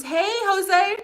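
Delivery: high, loud, sing-out hello; bright-pitched, loud call of welcome; calling out a greeting. Real, not acted. Greets a viewer joining the live.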